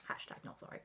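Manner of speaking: cheeky unvoiced whisper, off to the side; whispered aside. Real, not acted. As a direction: whisper it as a sly joke. Cheeky aside after apology.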